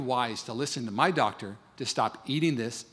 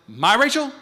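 alarmed question; 6 semitones higher, 5 dB louder, slower than usual. Retold or imitated. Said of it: "I'd say this".

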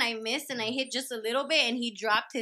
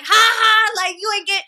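loud, high taunting "ha ha"; taunting laugh; 9 semitones higher, 9 dB louder than usual. Retold or imitated. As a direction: gloat right in his face.

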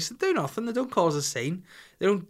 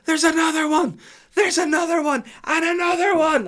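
put-on high, loud little girl shriek, much higher and louder; child shrieking; 9 dB louder than usual. Little daughter shrieks to dad about the bath, high loud child voice. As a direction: shriek like a panicked three-year-old. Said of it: "Holly starts".

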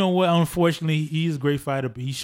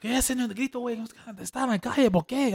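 higher, mock El Paso Spanish; mock Spanish slang; 11 semitones higher, 4 dB quieter than usual. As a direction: toss it off in street Spanish. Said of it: "I'm like".

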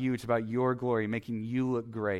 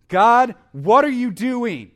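much higher and louder, slow, demanding God; accusing cry at God; 11 semitones higher, 12 dB louder, slower than usual. Shown as a demonstration. Preacher acting out a believer shouting at God in tragedy, angry. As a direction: hurl the question at God in protest. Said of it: "Do you see the difference?".

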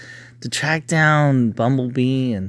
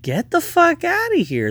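mock disbelief. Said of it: "I'm like".

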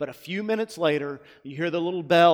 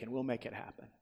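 whisper; 5 semitones lower, 13 dB quieter, faster than usual. Acted. Whispered end of the quote.

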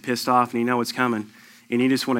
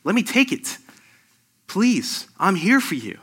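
higher, earnest pleading voice; pleading Jesus; 8 semitones higher, slower than usual. Retold or imitated. Pastor voicing Jesus begging to carry their pain, higher and pleading. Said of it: "He's sitting there saying".

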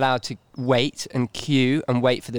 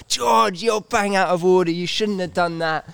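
high, raised-voice imitation of a telling-off; retold angry shout; 6 semitones higher than usual. Trainer telling off a younger trainer on the heath, mock shouting. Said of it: "He starts shouting at me".

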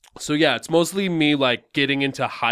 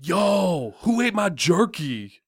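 higher, tough guy calling out; Chico voice; 5 semitones higher, slower than usual. Acted. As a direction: yell across the room about jerky. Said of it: "sample of what Chico sounds like".

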